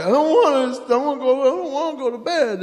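mock toddler whine; whiny little boy. Little son refusing bedtime.

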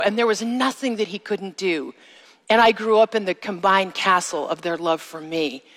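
usual speaking voice

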